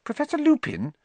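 put-on high, careful girl's voice; timid schoolgirl; timid Hermione. A narrator's reading. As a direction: ask shyly for a turn. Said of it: "said Hermione timidly".

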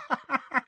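high cackle of laughter; mocking laugh. A narrator's reading. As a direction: burst into a cackle.

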